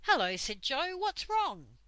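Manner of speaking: startled Joe. Acted. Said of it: "began to make a funny noise".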